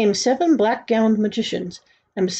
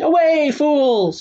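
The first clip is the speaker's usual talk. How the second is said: high, slow dismissive cry; shouting; 7 semitones higher, 4 dB louder, much slower than usual. A narrator's reading. Angry king dismissing his magicians, shouting. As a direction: banish them with a drawn-out shout. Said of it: "he shouted".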